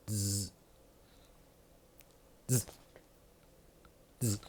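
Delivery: soft buzzing clipper noise through the teeth. Retold or imitated. To viewers, mimicking the stylist's clippers with a soft buzz. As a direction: hum it like an electric trimmer, quietly.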